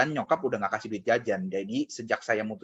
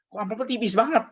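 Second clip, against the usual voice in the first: high, loud cry of disbelief at the envelope; shocked disbelief; 11 semitones higher, 4 dB louder than usual. Retold or imitated. Young employee shocked at his thin pay envelope, high disbelief. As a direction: react like the envelope is suspiciously thin.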